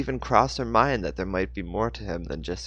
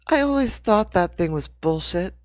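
higher, louder gloating voice; triumphant; 10 semitones higher, 5 dB louder than usual. A narrator's reading. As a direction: crow a little victory. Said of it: "Rachel said with triumph".

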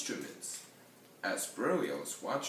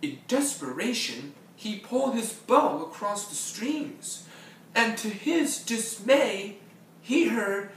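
high, loud, mounting urgency; desperate narration; 11 semitones higher, 10 dB louder than usual. A narrator's reading. Narrator as Berlioz tries his bow, loud desperate build. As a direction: drive the climax forward at full voice.

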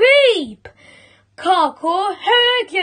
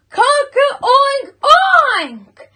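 high rooster crow turning into oinks; shouted crow; 10 semitones higher, 4 dB louder than usual. A narrator's reading. Cockerel trying again to crow, shouted. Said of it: "So he tried again".

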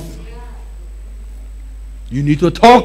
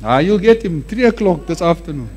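usual speaking voice

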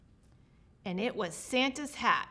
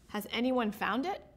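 ordinary delivery, nothing marked

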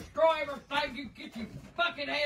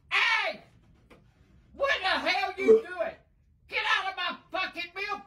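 higher, indignant scolding; angry yell; 5 semitones higher than usual. Real, not acted. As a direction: yell at the grandson to back off. Grandma catches grandson drinking her milk.

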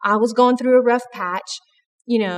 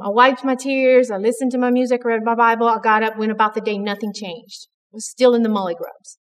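ordinary talk, nothing marked

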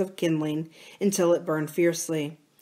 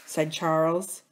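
usual speaking voice

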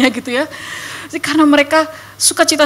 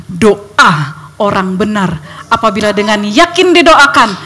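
preaching; 2 semitones higher, 7 dB louder than usual. Acted. Preacher quoting scripture to the church, building to loud shout. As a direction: rise to full preaching force on kuasanya.

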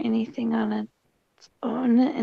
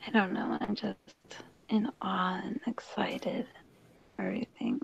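hushed whisper so nobody wakes; whispering late at night; quiet at night. Real, not acted. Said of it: "I'm talking quiet because my roommates are asleep".

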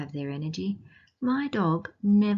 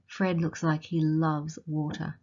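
usual speaking voice

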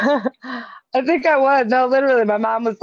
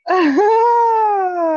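laughing; 11 semitones higher, 5 dB louder than usual. Real, not acted. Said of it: "laugh after friend's singing".

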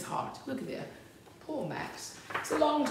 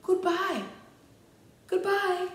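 little farewell calls; 12 semitones higher, slower than usual. Acted. Max waves to the wild things, little farewell calls. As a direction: call bye in a small high voice.

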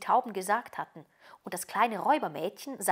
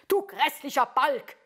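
higher, louder scolding; cross scolding mother; 7 semitones higher, 4 dB louder than usual. A narrator's reading. Mother to the daughter who bit her ear, cross scolding. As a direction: scold the horrid brat.

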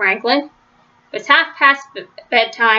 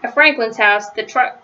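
ordinary delivery, nothing marked

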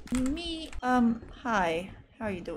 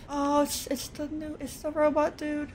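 ordinary talk, nothing marked

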